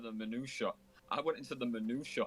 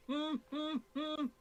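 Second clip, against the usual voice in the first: high, squeaky signal hums; much slower than usual. Retold or imitated. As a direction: hum the code high. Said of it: "we'd be like".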